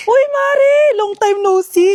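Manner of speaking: high, bright call to a friend; excited greeting. Acted. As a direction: greet her like a long-lost friend. Gossiping neighbors greet loudly.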